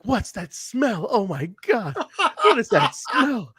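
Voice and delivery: high, drawn-out imitation of appalled disgust; hammed-up diva revolted by a stench; mock disgust. Acting out her disgust at a smell.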